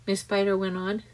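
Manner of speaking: usual speaking voice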